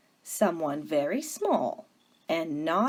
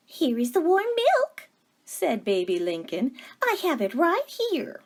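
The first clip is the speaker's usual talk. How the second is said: higher, eager voice of a kind younger sister; sweet Baby Lincoln; 8 semitones higher than usual. Acted. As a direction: ask it brightly, hopeful and gentle.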